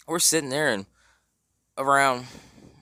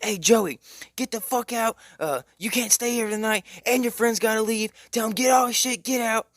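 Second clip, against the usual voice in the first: high-pitched mock yelling rant; screaming mom; 10 semitones higher, 4 dB louder than usual. Mom screaming at her son about his friend, mock yell. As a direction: imitate an enraged mother ordering people out. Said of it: "So she's screaming at him".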